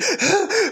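sharp high gasp of a pushing woman; hammed-up birthing gasp; mock labor gasp.

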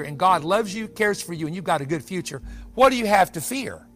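usual speaking voice

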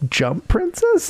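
high, slow disbelieving sneer; scoffing at the silly nickname; incredulous mockery. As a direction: stretch it out in mock disbelief. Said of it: "I'm like".

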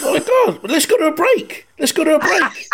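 very high flustered panic; panicked presenter. Retold or imitated. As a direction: scramble to cut to a break in panic. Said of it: "Like this".

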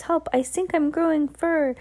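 high-pitched imitation of a panicking boy; mock scream. A narrator's reading. Boy beside her finding fur, high mock scream. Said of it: "A boy standing next to her screams".

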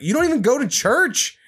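high, disbelieving jab; incredulous retort. Retold or imitated. Son mocking his churchless dad, high and incredulous. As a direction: throw it back, incredulous and high.

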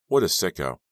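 plain reading voice

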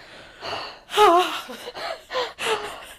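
big, drawn-out mock gasp; theatrical intake of breath; shocked gasp. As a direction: gasp high in exaggerated scandalized shock. Her childhood reaction to the line.

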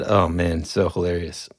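usual speaking voice

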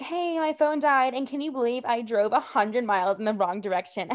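put-on innocence cracking into a laugh; mock innocent phone call. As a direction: imitate a breezy excuse on the phone. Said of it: "And she's like".

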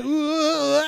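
high, loud ooh; host delighted at working in the band name; gleeful ooh. Real, not acted. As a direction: crow over sneaking the pun in. Pleased with his Megadeth pun.

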